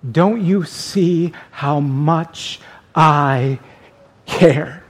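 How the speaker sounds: slow, softened plea with a pause before the last word; tender plea. Shown as a demonstration. Jesus showing the doubter how much he cares, tender plea. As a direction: let it sink low and slow, tenderly. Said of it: "more of a plea".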